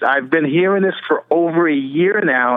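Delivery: ordinary talk, nothing marked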